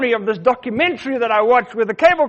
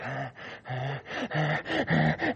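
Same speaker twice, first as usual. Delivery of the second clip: scared gasps; 6 semitones lower, 12 dB quieter, much slower than usual. Retold or imitated. Child frightened by dark shape.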